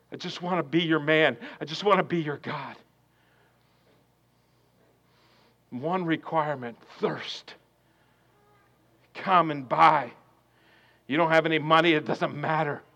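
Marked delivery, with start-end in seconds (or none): 0.1-11.9 hushed. Acted. Sung line then hushed.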